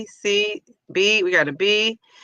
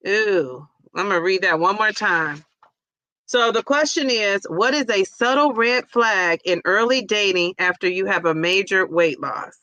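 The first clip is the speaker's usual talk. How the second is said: ordinary talk, nothing marked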